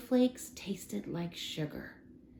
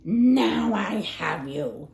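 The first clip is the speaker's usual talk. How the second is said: slow, louder gloating mouse king voice; squeaking mouse king; 5 dB louder, slower than usual. A narrator's reading. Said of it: "squeaked the King of the Mice".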